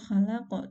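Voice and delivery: khalaqo with a clear short final o; correct reading demo. Shown as a demonstration. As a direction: say khalaqo cleanly, short vowels. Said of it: "harusnya dibacanya tu khalaq tu".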